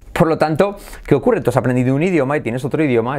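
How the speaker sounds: normal talking voice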